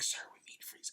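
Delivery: hushed whispered aside; quiet aide. Retold or imitated. Aide quietly prompting the old president, hushed mock whisper. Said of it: "And it's like".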